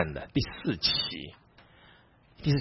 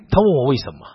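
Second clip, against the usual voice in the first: ordinary talk, nothing marked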